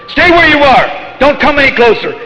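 loud, high warning that eases off toward the end; child Krishna threatening. Acted. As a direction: call out a stern threat as the boy Krishna. Voices child Krishna threatening someone.

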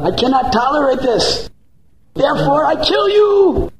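goddess rising to wrath. Acted. Goddess Chandi explaining her rage to the dacoit, building fury.

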